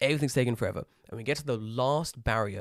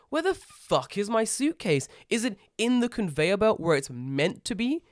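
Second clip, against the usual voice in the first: frustrated passengers; 6 semitones higher than usual. Retold or imitated. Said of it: "people just like".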